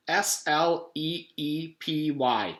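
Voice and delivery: slow letter-by-letter spelling. Acted. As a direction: spell each letter carefully.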